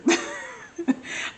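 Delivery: airy embarrassed giggle up high; high breathy laugh. Real, not acted. Laughs at own awkwardness.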